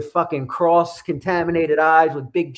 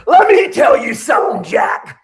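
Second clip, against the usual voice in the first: loud, higher, laughing wrestler promo shout; Stone Cold promo impression; 6 semitones higher, 7 dB louder, slower than usual. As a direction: roar it at the camera like a wrestling heel. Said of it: "cutting a Stone Cold Steve Austin type of promo".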